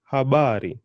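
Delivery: habari joined up with a strong middle BA; stress on ba. Shown as a demonstration. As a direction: put the weight on ba in habari. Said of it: "the emphasis is going on the ba".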